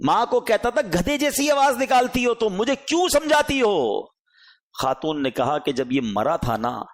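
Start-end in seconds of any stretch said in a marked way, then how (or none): 0.8-4.0 mocking son. Son mocks his mother.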